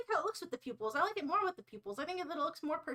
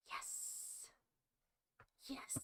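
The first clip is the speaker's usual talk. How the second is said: whispered yes; 17 dB quieter than usual. Real, not acted. Quiet triumph over result.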